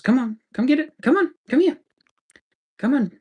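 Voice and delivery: high coaxing voice for the dog; high doggy talk. Real, not acted.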